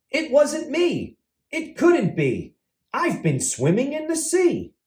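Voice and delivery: high, unhurried denial; turtle character. Acted. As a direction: lift the voice high and protest innocently. Turtle denies eating cupcakes.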